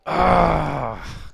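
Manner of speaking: loud, long exasperated "ah"; frustrated groan. Real, not acted. Gamer annoyed at losing everything, loud frustrated groan. Vents frustration over lost loot.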